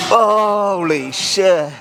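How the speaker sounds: slow, drawn-out stunned exclamation. Real, not acted. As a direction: stretch it out in disbelief. Stunned by smelling salts.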